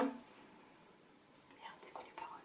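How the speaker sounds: whispered, lost for the lyrics; panicked whisper. Retold or imitated. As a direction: hiss it like you forgot the words. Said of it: "Moi, je suis là". (chuchoté, paniqué)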